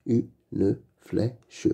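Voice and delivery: Une flèche split into u, ne, flè, che; syllable by syllable. Shown as a demonstration. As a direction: sound out "Une flèche" with gaps between syllables. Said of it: "Lis les mots".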